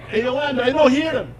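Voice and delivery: dad turning to his wife, baffled; Italian accent. Retold or imitated. Dad turning to Yolanda, high baffled Italian accent. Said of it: "voicemail on my phone that says".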